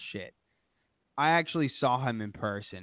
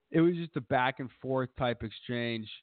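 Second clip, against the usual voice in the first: ordinary delivery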